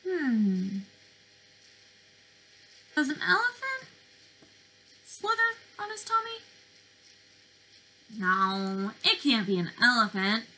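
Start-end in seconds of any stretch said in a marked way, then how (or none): 2.9-6.4 high, fast playful doubt; playful high-pitched wondering; mock puzzled question. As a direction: tease the kids with a silly guess.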